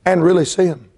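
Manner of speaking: ordinary talk, nothing marked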